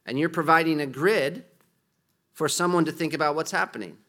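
ordinary talk, nothing marked

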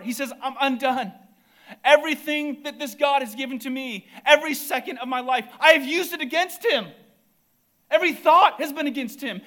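high, loud, building preacher's cry; preaching at full force. Acted. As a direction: thunder it from the pulpit, climbing.

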